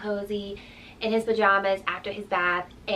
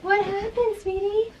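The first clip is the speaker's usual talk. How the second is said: soothing; 11 semitones higher than usual. Real, not acted. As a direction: gently comfort a little one. Mother soothing her crying toddler.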